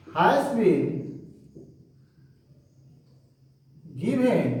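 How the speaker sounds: slow, word-by-word dictation of a rule. Shown as a demonstration. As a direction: spell the rule out slowly for students to copy. Teacher dictates rule slowly. (धीमे-धीमे लिखवाना)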